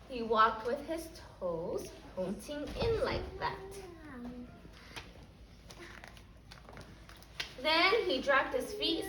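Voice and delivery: put-on lively storybook reading for little kids; reading to children. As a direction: read it like story time for a class. Teacher reads picture book aloud.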